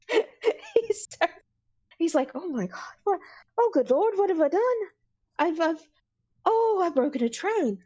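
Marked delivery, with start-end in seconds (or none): 2.3-7.8 mildly hammed-up horror, rising in pitch; dawning panic. Bird character realizing he broke a train, dawning panic. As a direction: play a dismayed "what have I done" realization. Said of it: "just settling in all of a sudden".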